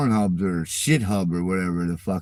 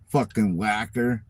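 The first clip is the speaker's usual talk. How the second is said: usual speaking voice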